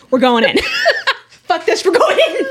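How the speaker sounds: high, loud, laughing battle cry; excited outburst. Real, not acted. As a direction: throw caution away, laughing out loud. Player gleefully decides to charge in.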